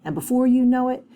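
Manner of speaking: ordinary talk, nothing marked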